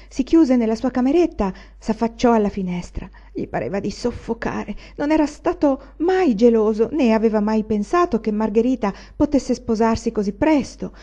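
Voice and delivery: plain reading voice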